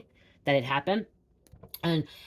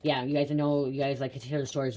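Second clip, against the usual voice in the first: usual speaking voice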